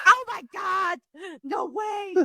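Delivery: high, shouted fake amazement; mock fan shriek. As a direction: squeal it like an overexcited superfan. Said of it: "everyone in the new era is like".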